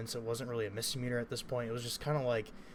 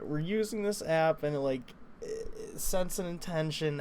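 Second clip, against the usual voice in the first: higher, slower, halting over the words; stumbling nervous words; 5 semitones higher than usual. Retold or imitated. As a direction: trip over the explanation, rattled. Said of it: "I just stumble my words".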